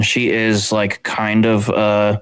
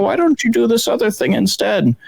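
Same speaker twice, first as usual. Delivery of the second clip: much higher mock-sweet voice; condescending shop worker; 12 semitones higher than usual. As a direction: talk down to a young customer in a high put-on voice. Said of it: "the other person is like".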